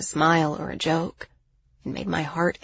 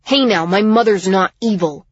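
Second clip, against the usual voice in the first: protesting; 2 semitones higher, 7 dB louder than usual. A narrator's reading. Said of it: "Miles tried".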